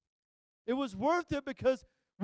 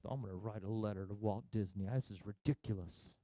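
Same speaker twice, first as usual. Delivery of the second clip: low, much softer grumbling to himself; muttering under breath; 14 semitones lower, 9 dB quieter than usual. Retold or imitated. A grumpy father muttering about writing to Walt Disney. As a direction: mutter it so nobody hears. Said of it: "mumbling in my breath".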